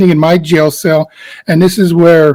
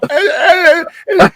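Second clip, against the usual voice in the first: frantic stammer; 11 semitones higher than usual. Retold or imitated. Frantic improvised acting retold.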